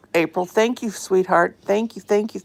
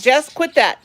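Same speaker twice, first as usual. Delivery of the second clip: louder, higher "quit that" to the bird; sharp scold; 6 semitones higher, 8 dB louder than usual. Real, not acted. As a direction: stop the bird with a quick firm call. Owner scolds bird picking at cage.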